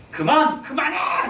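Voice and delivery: a half-acted cry to stop it; mock yell. Pastor acts out the angry crowd for his church, mock yelling. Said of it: "라고 막 소리 질렀겠죠".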